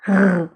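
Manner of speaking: "R" as a rasped uvular sound; French throat R. Shown as a demonstration. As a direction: roll "R" at the back of the throat. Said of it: "it comes from the throat".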